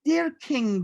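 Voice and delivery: put-on a child starting a letter. A narrator's reading. Boy writing letter to king.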